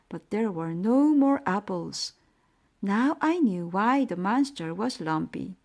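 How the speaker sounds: ordinary delivery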